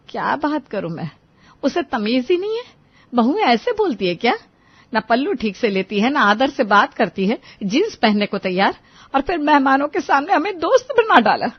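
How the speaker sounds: griping mother-in-law, pitch climbing as the list builds; indignant complaint. Acted. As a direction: complain to your son about his wife. Mother-in-law complains to her son. (सास की चिढ़ी हुई शिकायत)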